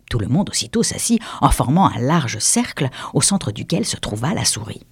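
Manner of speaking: plain reading voice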